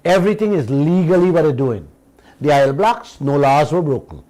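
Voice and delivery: usual speaking voice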